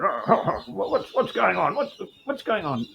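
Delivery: put-on a nosy old busybody bustling in; high, fluttery nosy-gent voice; fussy Mysterio. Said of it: "he comes over and says".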